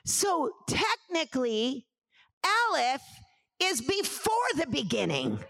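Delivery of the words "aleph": usual speaking voice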